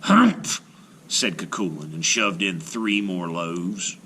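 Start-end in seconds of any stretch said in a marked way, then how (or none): 0.0-0.6 loud high disgruntled grunt; giant's humph. Acted. Giant grunting at Una's answer, disgruntled. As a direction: grunt in disgust.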